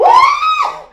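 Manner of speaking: hammed-up laughing wail; laughing shout. Real, not acted. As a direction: squeal it through laughter. Crying in shower laughing.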